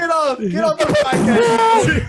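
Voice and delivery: high slow shocked yell; shout. Real, not acted. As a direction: yell it out in mock outrage.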